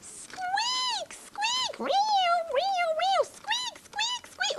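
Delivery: very high, repeated mouse squeals; piercingly high pretend animal squeals; frantic squeaking. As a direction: squeak like a toy mouse in trouble. Toy animals in trouble.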